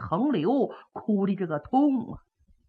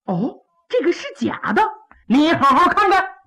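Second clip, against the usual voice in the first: higher, louder mocking challenge; sarcastic emperor; 7 semitones higher, 4 dB louder than usual. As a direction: taunt him to take a good look. Emperor mocks the denial.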